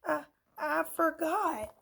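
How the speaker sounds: high, stammered moan. A narrator's reading. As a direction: whimper it out. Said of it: "moaned Eraser".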